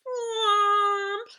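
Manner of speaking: a big booming thwomp; loud thwomp noise. Pretend. Teacher reading to her class, loud thwomp sound. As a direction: boom it out like a crash.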